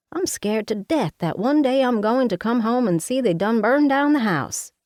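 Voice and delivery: a bossy Southern mama fussing over her daughter; Mama, Southern mother. Acted. Mama voiced in the story.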